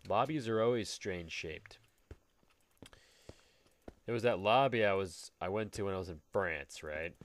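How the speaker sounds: ordinary talk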